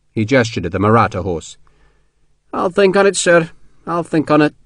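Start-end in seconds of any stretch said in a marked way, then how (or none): 2.5-4.5 higher character voice, glumly noncommittal; reluctant, gloomy Scotsman. A narrator's reading. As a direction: put off the decision gloomily. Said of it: "McCandless said gloomily".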